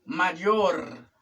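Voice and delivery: "Mayor" ending in a clear R; "Mayor" as ma-YOR; correct R. Shown as a demonstration. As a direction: say "Mayor" with final R, not L. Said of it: "es mayor".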